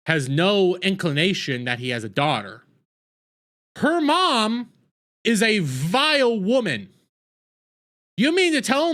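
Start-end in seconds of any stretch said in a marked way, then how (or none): 3.8-8.9 high, drawn-out outraged declaration; real indignation. Real, not acted. Reviewer condemning the mother who hid her baby, indignant. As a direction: pronounce it in slow, high-pitched outrage.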